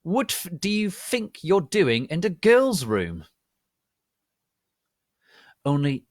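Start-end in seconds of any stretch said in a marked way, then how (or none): 0.0-3.2 angry. A narrator's reading. Scolding intruder in bathroom.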